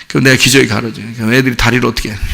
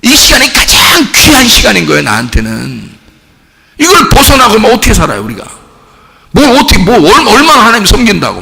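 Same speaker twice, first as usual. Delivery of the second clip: high, loud, impassioned sermon delivery; rising pulpit fervor; fiery preaching; 11 semitones higher, 5 dB louder than usual. Acted. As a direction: preach it full force to the congregation. Preaching at full force.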